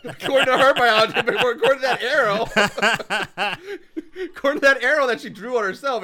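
words broken up by laughter; laughing. Real, not acted. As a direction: laugh through the whole line. Laughing about arrow drawing.

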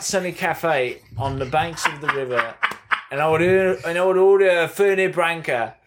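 put-on light London butler accent, fond and a touch choked with a laugh; a hint of a tender old butler; Michael Caine. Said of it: "I gave you the Michael Caine impression".

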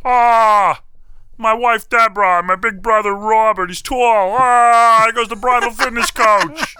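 high, loud whiny Ray Romano voice; Ray Romano impression. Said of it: "I can do Ray Romano".

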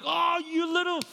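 mock furious preacher scolding a disciple; raging Jesus. Shown as a demonstration. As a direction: rage at Peter full force. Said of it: "He's not full on raging".

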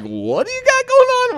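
very high mock-baffled voice; hotel clerk asks what's going on, very high and baffled; incredulous clerk. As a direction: play the puzzled hotel clerk. Hotel clerk reacting to request.

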